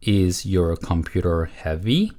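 slow, word-by-word model question; model reading. Shown as a demonstration. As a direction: read it slowly for learners to repeat. Teacher models answer for repetition. (천천히 또박또박 읽는 시범)

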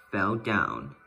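ordinary delivery, nothing marked

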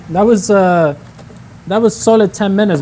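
ordinary talk

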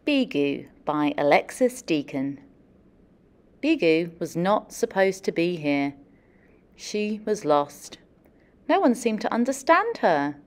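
picture book story narration; storybook reading. Acted. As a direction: tell it gently to a class of kids. Reads picture book aloud.

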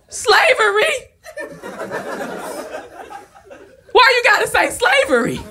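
shrill outrage of a heckler; drunk heckler woman. Retold or imitated. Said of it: "Drunk woman stood up".

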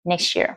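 fuse "Next year" with a ch sound; "Next year" linked as one word; ch linking. Shown as a demonstration. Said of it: "Thành âm ch".

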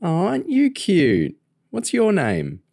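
put-on high, slow sing-song to a toddler; baby talk. A narrator's reading. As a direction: coo it like talking to a baby. Lauren talks to baby Joshua.

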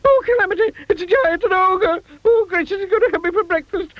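very high, wailing hysteria; screaming in terror. A narrator's reading. Mister Jelly thinks he'll be breakfast, terrified screaming. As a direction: squeal and end in a long wail. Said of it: "he screamed".

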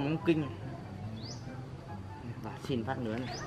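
hushed, trailing musing; soft private grumble; muttering to himself. Acted. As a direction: think aloud very softly. Musing alone before asking again.

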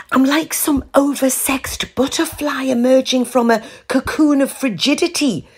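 high, dreamy and gushing; lyrical. A narrator's reading. Val gushing lyrically to her friend Ruth. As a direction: gush it like poetry. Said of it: "Val told Ruth somewhat lyrically".

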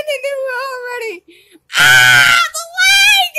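high loud laughing yells; laughing shouts. Real, not acted. Gamer laughing at the game, loud yells.

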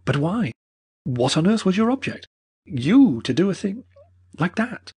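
disbelieving and hurt; reproachful Mortimer. Acted.

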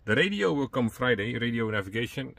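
ordinary talk

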